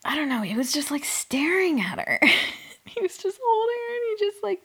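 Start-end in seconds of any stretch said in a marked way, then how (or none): 2.9-4.6 laughing. Real, not acted. Laughing retelling.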